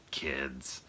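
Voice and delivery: low, quiet resigned aside; deadpan aside. Retold or imitated. Freddy breaks the fourth wall before exploding, deadpan aside. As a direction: drop it softly, straight to camera. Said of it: "breaks the fourth wall, and goes".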